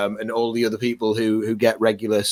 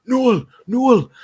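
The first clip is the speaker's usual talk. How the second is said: higher, drawn-out Geordie crowd call; imitated Geordie accent; 12 semitones higher, 4 dB louder than usual. Newcastle fans in the crowd calling, Geordie accent. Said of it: "he could hear all the accents in the crowd going".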